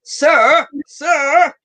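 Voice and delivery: mock stern judge; a hint of a scolding judge; lightly voiced TV judge; Judge Judy. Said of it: "Judge Judy".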